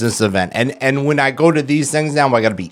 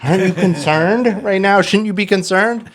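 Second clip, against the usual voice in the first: higher, strained, anxious questions; anxious pleading; 9 semitones higher than usual. Retold or imitated. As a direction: press her in rising panic. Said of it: "I'm like".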